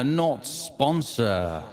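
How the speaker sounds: slow, drawn-out echoing phrase; reverb echo. Shown as a demonstration. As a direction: let it ring with heavy reverb. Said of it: "I just love the F X".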